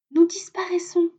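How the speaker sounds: high, bright bird voice; little robin voice. A narrator's reading. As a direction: voice a tiny cheerful robin. Robin speaking.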